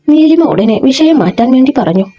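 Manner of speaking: usual speaking voice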